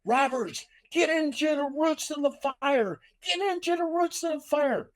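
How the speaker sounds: high mock yell of a captain. Captain yelling orders at his rookie, high mock yell. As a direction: bark the order like an angry fire captain. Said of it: "my captain was yelling at me and goes".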